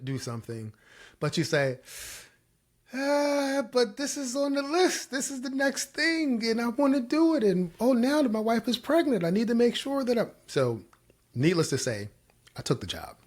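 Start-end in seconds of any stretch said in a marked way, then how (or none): 2.9-10.3 very high-pitched comic excuse-making; mock protesting. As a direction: plead your case in a squeaky put-on voice. Mimics excuse against what one should do.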